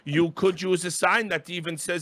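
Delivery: hammed-up humourless official; imitating ESL producer. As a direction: scold with pedantic care. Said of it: "But he kept going".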